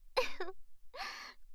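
soft airy giggle; breathy giggle. Acted. Breathy laugh about her outfit.

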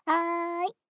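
perky eager reply; cheerful okay. Acted. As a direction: sing out an okay.

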